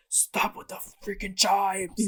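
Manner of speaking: fed-up squirrel yelling at the player; mock angry outburst. Annoyed squirrel snaps at player.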